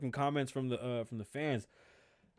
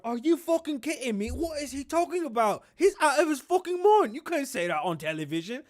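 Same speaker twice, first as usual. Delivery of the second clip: Prince Harry impression; 8 semitones higher, 5 dB louder than usual. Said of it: "I can see Prince Harry going".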